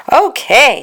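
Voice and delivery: high, loud delighted okay; happy little boy. A narrator's reading. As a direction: chirp it out.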